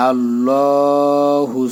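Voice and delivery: আল্লাহু slow, heavy, one alif long; slow, full আল্লাহু; drawn heavy Allahu. Shown as a demonstration. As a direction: stretch আল্লাহু full-mouthed. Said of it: "recitation demo".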